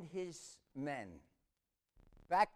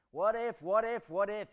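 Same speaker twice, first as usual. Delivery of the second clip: high, loud, fretful repetition; anxious what-ifs; 6 semitones higher, 10 dB louder than usual. Acted. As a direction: spin the what-if wheel out loud. Preacher acts spiralling what-if worry.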